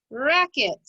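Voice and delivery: "racket" blended quickly into one joined word; said fast. Shown as a demonstration. Teacher showing pupils the finished word, said fast. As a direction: run both syllables of "racket" together without a gap. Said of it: "Say it fast".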